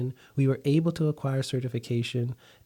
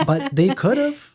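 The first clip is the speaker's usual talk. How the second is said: high, louder teasing aside; playful emphasis; 10 semitones higher, 7 dB louder than usual. Real, not acted. Joking with the host about the stolen client, high and playful. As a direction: tease the listener with it.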